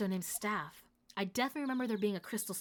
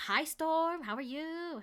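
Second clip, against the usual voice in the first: bright raised hello to a viewer; excited greeting; 8 semitones higher, 4 dB louder, much slower than usual. Real, not acted. Streamer greeting a chat viewer, excited. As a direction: perk up and greet them.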